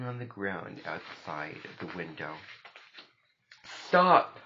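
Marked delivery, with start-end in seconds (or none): 3.6-4.4 high, loud shout of alarm; loud, sharp-pitched yell. A narrator's reading. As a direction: shout it out to halt someone. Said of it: "I shouted".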